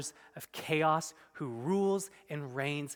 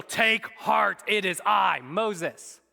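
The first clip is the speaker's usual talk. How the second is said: louder, a touch higher pronouncement; mock heroic declaration; 4 semitones higher, 5 dB louder, much slower than usual. Preacher contrasting a boastful Moses with Jesus, mock-proclaiming. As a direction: puff up "Take heart" like a would-be hero.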